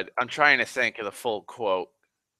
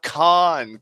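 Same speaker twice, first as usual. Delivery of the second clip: a louder mock yell of the name; imitated yell; 4 semitones higher, 6 dB louder than usual. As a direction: call the name out in a retold yell. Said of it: "that's why Kirk yells out".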